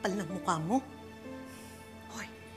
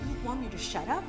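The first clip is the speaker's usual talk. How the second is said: quiet menace; 6 semitones lower, 8 dB quieter than usual. Acted. Defiant reply in quarrel.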